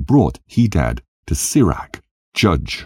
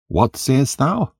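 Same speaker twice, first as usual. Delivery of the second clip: high, slow, mock-puzzled question; feigned surprise; 10 semitones higher, slower than usual. Hadad to the traveller, pretending not to understand, high and slow. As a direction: act baffled, drawing it out high.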